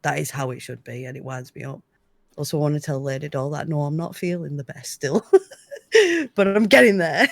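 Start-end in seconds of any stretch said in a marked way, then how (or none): 4.9-7.3 real laughter breaking into the words; giggling between phrases, pitch jumping up; laughing. Real, not acted. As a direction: laugh your way through admitting it. Admits feeling unwell, laughing.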